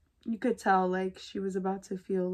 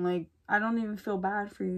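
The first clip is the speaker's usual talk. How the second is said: ordinary talk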